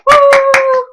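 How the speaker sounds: high, very loud woo; whooping cheer. Real, not acted. Gamer celebrating trick with viewers, loud high whoop. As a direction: let out a joyful whoop.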